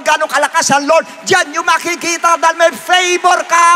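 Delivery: high, driving preacher's build-up; full-force preaching. Real, not acted. Preacher proclaims God's power to believers, full-force preaching. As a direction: pile phrase on phrase with fervor.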